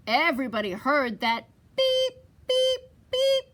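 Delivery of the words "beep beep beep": high, loud, evenly spaced beeps; horn beeps. A narrator's reading. Everybody hearing the truck's call, high beeps. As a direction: imitate a little horn. Imitated horn heard by all.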